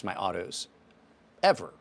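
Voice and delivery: ordinary talk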